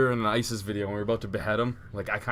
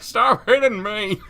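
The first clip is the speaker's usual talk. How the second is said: put-on high, loud, drawn-out old man's protest; hammed-up grandpa complaint; mock grandpa; 9 semitones higher, 6 dB louder, much slower than usual. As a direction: wail it slowly like a put-upon grandpa. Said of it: "he looked like a grandpa being like".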